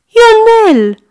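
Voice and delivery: high, loud shout of Ionel; shouting the name. Acted. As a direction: yell for the boy again. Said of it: "strigă iar Madame Popescu".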